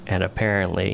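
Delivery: ordinary talk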